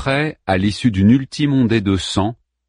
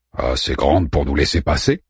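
low, cautious question; Orpheus's voice; 7 semitones lower than usual. A narrator's reading. Orpheus asks whether they fit through, low question. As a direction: ask it in a lowered companion voice. Orpheus answers in his figure voice.

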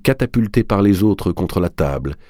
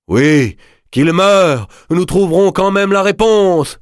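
crié, aigu et fort; s'écrie chavirée; 13 semitones higher, 6 dB louder, slower than usual. Acted. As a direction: exige la mort avec fureur. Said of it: "s'écriait tout autant chaviré". (madame Olcott s'écrie, chavirée, aiguë et forte)

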